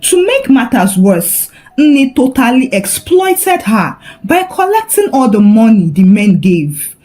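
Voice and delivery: ordinary delivery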